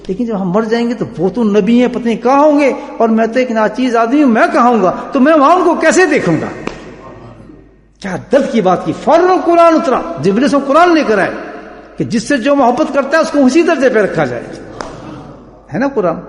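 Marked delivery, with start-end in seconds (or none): 0.1-6.5 raised, pleading preacher's re-enactment; impassioned companion. Acted. Companion fearing separation from the Prophet, impassioned plea. 9.0-14.4 high, forceful proclamation; fervent preaching. Acted. Preacher proclaiming the Quran's answer to the companion, fervent.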